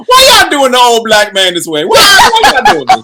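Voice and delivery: high, loud playful protest; mock outrage. Teased cohost protests to the others, mock outrage. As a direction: wail a joking complaint, pitch climbing way up.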